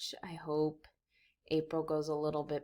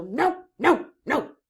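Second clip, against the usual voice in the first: loud repeated No; defiant refusal; 5 semitones higher, 9 dB louder than usual. Acted. Tornado Joe refusing, loud and stubborn. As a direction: stamp each no.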